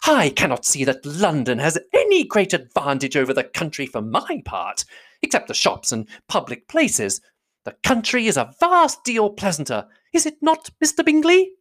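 high, insistent, climbing in pitch; fussy mother rising to a high question; triumphant matron. A narrator's reading. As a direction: press your victory, ending on a high appeal. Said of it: "continued her triumph".

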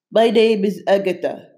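stuffy-nosed monster voice; blocked nose. A narrator's reading. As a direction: talk through a stuffed nose. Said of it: "through a stuffed noses".

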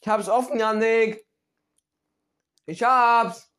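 loud triumphant yell; excited shout. Real, not acted. Finally opened candy, calling out.

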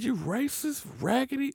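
imitating losing it, without the volume; retold outburst. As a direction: go off on them in a squeaky, held-back voice. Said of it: "I went the fuck off".

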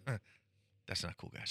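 quiet mock disapproval. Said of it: "And he goes".

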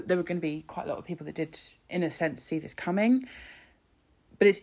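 ordinary talk

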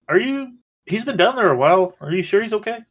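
put-on high, worried little kid; worried child voice. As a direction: ask anxiously, like a small child. Child worried about friend.

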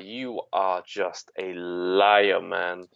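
slow. Retold or imitated. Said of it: "I was just like".

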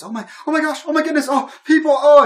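high, loud burst of delight; thrilled squeal of a castaway finding company; overjoyed amazement. Acted. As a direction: gush in breakless, high-pitched excitement. Game master voices Lydia finding people.

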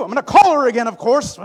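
very high and loud, giddy resolve; triumphant shout. Retold or imitated. Son to mom, giddy loud declaration. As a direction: announce it like a victory.

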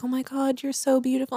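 imitating a gushing TV shopper; gushing imitation. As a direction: breathe it out like a starstruck fan. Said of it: "they're like".

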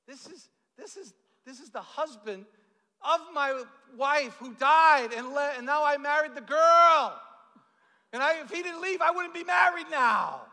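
high-pitched lament building to a loud shout; mock anguished wail. Mourner explaining whose grave it is, mock wailing. Joke punchline, mourner wails about his marriage.

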